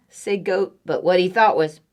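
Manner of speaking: ordinary delivery, nothing marked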